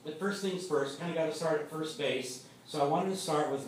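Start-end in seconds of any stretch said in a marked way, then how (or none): none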